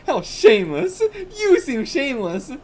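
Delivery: high, loud prim scolding; high scolding girl. Acted. Girl scolding the shameless boy, high and loud.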